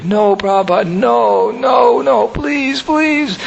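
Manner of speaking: mock tearful plea, climbing high; pleading. Brahmananda on his knees begging Prabhupada, high imitated pleading. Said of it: "began crying, sobbing like a baby".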